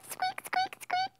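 tiny high squeaks of a mouse; squeaky mouse. Acted. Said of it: "said the mouse".

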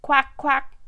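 high, loud short quacks; duck quack. A narrator's reading. Storyteller as the duck calling to the spider, loud quacking. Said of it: "called the duck".

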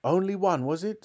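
high, mocking question; sneering. A narrator's reading. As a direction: taunt as the scornful cook. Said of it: "sneered the cook".

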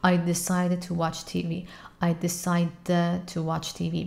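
slow "decided to" with one t only; "decided to" slurred together. Shown as a demonstration. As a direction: say just one t between "decided" and "to".

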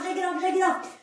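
ordinary talk

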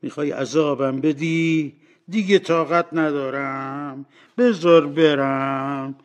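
slow, anguished plea; pleading. Acted. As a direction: beg to be let go, stretching the words. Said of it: "بعد با التماس به روح گفت".

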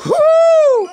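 loud, piercingly high hoot; high whoop. Real, not acted. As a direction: let out a high frustrated whoop. Cries out after lost play.

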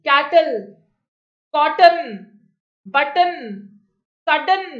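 कैटल, कॉटन, बटन, सडन one by one, final L or N sounded as its own syllable; example words sounded out. Shown as a demonstration. Teacher showing where the sound comes, कैटल, कॉटन, बटन, सडन with distinct syllabic endings. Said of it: "आवाज आ रही है".

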